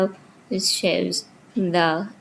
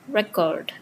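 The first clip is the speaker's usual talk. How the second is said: "record" said re-CORD; second-syllable stress; 4 dB quieter than usual. Shown as a demonstration. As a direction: put the weight of "record" on its last syllable. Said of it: "stress falls on the second syllable".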